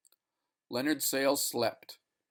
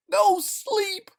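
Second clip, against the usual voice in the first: very high, loud anguished cry; horrible scream; 11 dB louder, much slower than usual. Acted. Delirious man fighting the needle, horrified scream. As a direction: scream in horror. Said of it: "he mouthed horribly".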